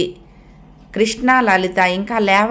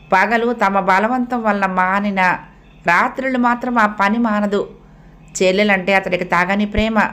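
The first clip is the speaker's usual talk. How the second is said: plain reading voice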